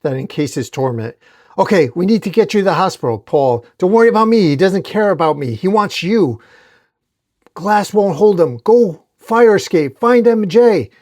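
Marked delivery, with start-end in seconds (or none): 1.6-6.4 high, loud, hurried insistence; raised-voice alarm; urgent shouting. A narrator's reading. Urgent exchange while under attack. 7.5-10.9 high, loud escape orders; emphatic yelled urgency; urgent commands. A narrator's reading.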